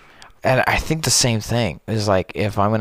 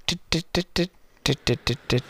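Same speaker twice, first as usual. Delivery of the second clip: imitating a high note; 7 semitones higher, 4 dB quieter than usual. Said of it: "It was like".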